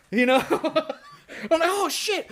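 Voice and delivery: high laughing oh shit. Retold or imitated. Telling friends about waking, a high laughing oh shit. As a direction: laugh through the shock.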